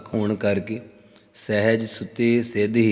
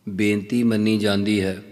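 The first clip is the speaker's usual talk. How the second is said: ordinary talk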